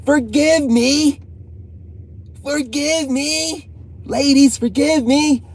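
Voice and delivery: high, slow, hammed-up begging; mock pleading. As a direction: wail it like a dramatic apology. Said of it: "I had a moment of weakness".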